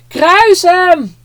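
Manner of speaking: high, loud mob cry; shouted. Acted. One man in the crowd calls out to Pilate, shouting high and loud. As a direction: scream it from the crowd. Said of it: "een ander begint het ook te roepen".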